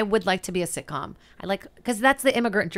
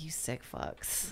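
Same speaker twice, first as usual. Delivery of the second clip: disgusted; 6 semitones lower, 15 dB quieter, much slower than usual. Retold or imitated. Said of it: "I'm like".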